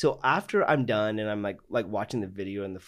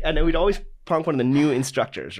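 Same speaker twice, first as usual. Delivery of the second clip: usual speaking voice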